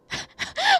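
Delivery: shrill, loud nervous burst of laughter; nervous high laugh. Real, not acted. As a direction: laugh out the fright. Nervous laugh after scare.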